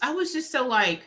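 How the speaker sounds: ordinary talk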